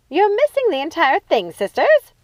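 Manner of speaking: high loud exasperated yell; shouted rebuke. A narrator's reading. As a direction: yell to get their attention. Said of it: "yelled Lucinda".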